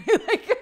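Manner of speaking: loud high burst of laughter; laughing. Real, not acted. Laughing at her own idea.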